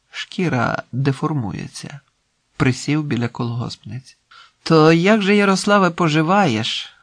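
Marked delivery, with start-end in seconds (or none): 4.6-6.9 higher, louder countrywoman's greeting; elderly village woman. Acted. Elderly collective farmer greeting Yaroslav, voiced old woman. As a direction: voice an old woman asking after an acquaintance. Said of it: "спитала літня жінка".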